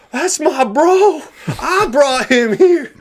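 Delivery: high, drawn-out crowing over a friend; proud cheering yell. Retold or imitated. Proud friend cheering in a retold memory.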